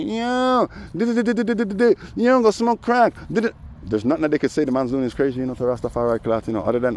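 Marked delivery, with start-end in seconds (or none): 0.0-3.6 high, squeaky mock jeer; cartoonish high taunting chant; mocking babble voice. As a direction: ham up the heckler's silly taunt.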